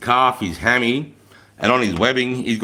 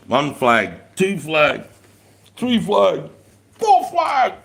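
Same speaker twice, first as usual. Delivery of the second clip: each count pitched higher, ending way up high; rising count; 7 semitones higher, much slower than usual. Pretend. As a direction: climb in pitch with every flag, last one squeaky high. Counting flags, rising each time.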